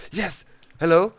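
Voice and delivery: loud, high, rising hello; eager greeting. Retold or imitated. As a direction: burst out at the door expecting the delivery. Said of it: "go like".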